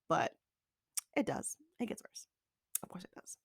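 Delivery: very soft, fading into a whisper; resigned quiet aside. Real, not acted. Vlogger admitting the chaos got worse, resigned whisper. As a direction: let it sink away to a bare whisper.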